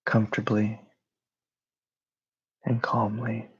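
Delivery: calm, even meditation voice with long pauses; guided meditation. Real, not acted. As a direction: guide them gently into rest, phrase by phrase.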